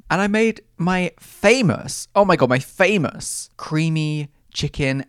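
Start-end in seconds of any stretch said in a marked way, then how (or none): none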